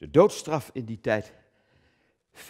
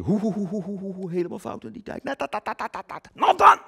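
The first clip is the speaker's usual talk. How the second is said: mock scandalised tutting; 11 semitones higher, 4 dB louder, faster than usual. Mock outrage, tut-tutting.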